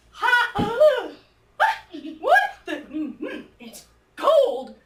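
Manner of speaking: gasping at icy water, acted out; acted cold shock. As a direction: yelp and shudder at the cold.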